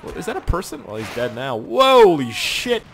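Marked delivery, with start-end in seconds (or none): 1.6-2.8 long drawn-out, loud, high exclamation; amazed shout. Real, not acted. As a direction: stretch it into a big astonished yell. Sees bodies flying.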